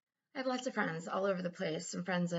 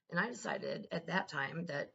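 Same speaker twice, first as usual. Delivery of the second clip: ordinary delivery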